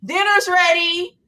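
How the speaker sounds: slow, high call to the house; yelling across the room. Pretend. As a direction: draw it out to call everyone in. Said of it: "Ringing the bell".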